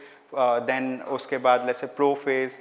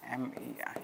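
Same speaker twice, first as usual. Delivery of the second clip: barely audible whisper to oneself; whispered spelling; 4 semitones lower, 17 dB quieter than usual. Real, not acted. Teacher spelling a word while writing, whispered to self. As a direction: whisper the letters under your breath.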